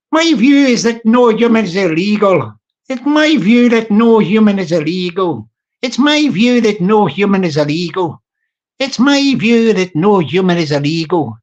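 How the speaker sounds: toned-down South Dublin twang, lilting; South Dublin Irish accent. Acted. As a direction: give it a nice little Irish twang. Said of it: "I toned it down to get a nice little twang".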